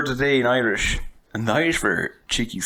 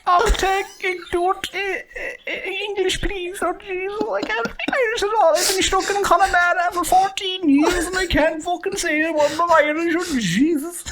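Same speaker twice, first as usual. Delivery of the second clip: much higher, wailing mock breakdown; breaking down in an exam. Student cracking in the oral Irish exam, mock wailing. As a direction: crack up and plead for English. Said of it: "someone breaking down in the middle of the oral Irish".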